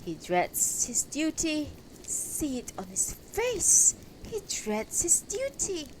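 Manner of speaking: high-pitched mocking woman; high, accusing character voice; another taunting woman. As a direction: taunt him in a high voice, for all to hear. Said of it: "And yet another".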